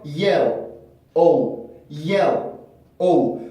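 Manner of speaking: "yell", "O"를 사이에 쉼을 두고 따로; 음절로 끊기. Shown as a demonstration. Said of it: "분명히 음절로 끊어서 발음하면". ("yell / O", 두 음절을 완전히 분리)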